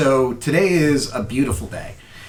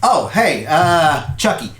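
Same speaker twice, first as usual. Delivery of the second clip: higher, louder puzzled dad; dad's voice; 6 semitones higher, 5 dB louder, slower than usual. Acted. Dad poking his head back in to ask his son, baffled.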